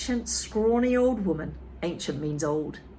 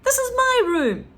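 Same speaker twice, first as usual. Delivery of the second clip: put-on high, loud boy's voice; young George; 7 dB louder than usual. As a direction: voice the boy George, high and urgent. George speaking up, excited.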